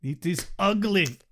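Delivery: put-on Austrian accent, clipped commands. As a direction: bark orders in a lightly Austrian accent. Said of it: "I can't do the Austrian accent".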